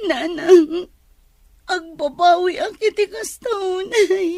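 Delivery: high, slow, tearful; crying plea. Acted. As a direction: beg through tears. Daughter begs mother's forgiveness. (agsangsangit a agpakpakawan)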